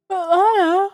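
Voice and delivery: loud, high cry of protest; desperate cry. Acted. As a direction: cry out to her in shock. Pleading with sister in game.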